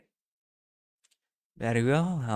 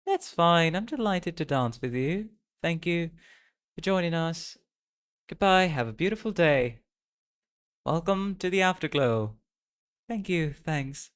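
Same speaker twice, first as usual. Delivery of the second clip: put-on raised, bright-natured girl's voice for the game host; cheerful character voice; 5 semitones higher than usual. Game hostess thanking dance guests, pitched-up character voice. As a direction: play the welcoming dancer, pitched up.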